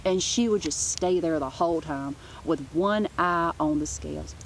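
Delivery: ordinary delivery, nothing marked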